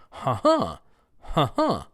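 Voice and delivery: "Huh, huh" as weak-STRONG beats; hummed-out huh-HUH; short first "Huh", long stressed second; second-syllable stress pattern. Shown as a demonstration. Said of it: "How about the action?".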